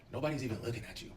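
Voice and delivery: soft, low, unbothered; calm and collected. Retold or imitated. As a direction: brush it off calmly. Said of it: "real calm and collected".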